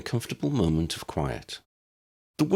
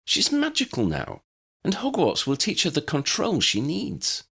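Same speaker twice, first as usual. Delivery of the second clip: put-on much higher, firm young woman; Hermione, insistent; 12 semitones higher than usual. A narrator's reading. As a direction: argue her case with conviction. Hermione voiced by narrator.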